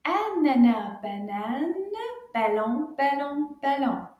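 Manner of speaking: rhythmic word-by-word rhyme for repeating; nice and loud recitation. Shown as a demonstration. Teacher leads children through French rhyme, loud rhythmic recitation. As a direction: chant each word clearly, with pauses. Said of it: "nice and loud".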